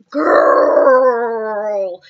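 one long word that says back off; stern "girl". Retold or imitated. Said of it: "And they're like".

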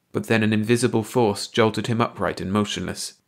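ordinary delivery, nothing marked

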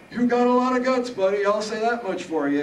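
put-on high, loud old-man howl; amused howl. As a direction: howl it at the top of Harold's voice. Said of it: "he howled".

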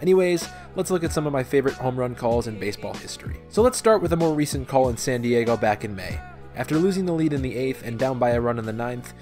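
ordinary talk

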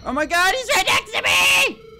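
high, loud shriek of alarm; panicked scream. Real, not acted. Player startled by the clown right next to him, panicked scream. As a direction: cry out in sudden terror.

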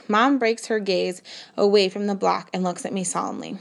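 ordinary delivery, nothing marked

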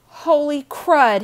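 loud, high, drawn-out outburst; sudden dismayed exclamation; sudden alarmed realization. A narrator's reading. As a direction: blurt it out high and loud, stretching the words. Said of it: "he said suddenly".

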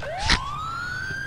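short high-pitched laugh. Real, not acted. Laughing at the driver whose car stopped, high-pitched laugh. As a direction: let out a quick high squeal of a laugh.

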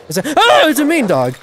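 loud high scared shout with a laugh; real panic mixed with a laugh; panicked yell. Real, not acted. Player yelling as a mean dog charges.

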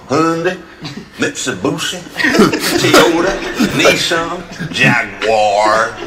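loud, mangled Hyundai, Mitsubishi, Toyota, Nissan, Jaguar in imitated Texan; Texan mispronunciations. Presenter mimicking the car dealer, mispronouncing Hyundai through Jaguar in Texan. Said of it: "he mispronounced every single one".

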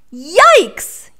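high, loud startled yelp; frightened yelp. A narrator's reading. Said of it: "yelped the lion".

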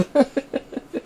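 high, trailing giggles; self-amused tittering; real giggle. Real, not acted. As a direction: giggle at your own joke. Laughs at own rap.

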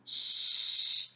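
"ch" as a long voiceless shhh; isolated ch sound. Shown as a demonstration. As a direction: hold "ch" softly, with no vowel. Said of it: "donnent le son". (« ch » soufflé et prolongé, sans voyelle)

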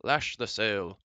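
mild, cheerful command called out; happy shout. A narrator's reading. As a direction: call out an order happily. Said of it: "Ron shouted happily".

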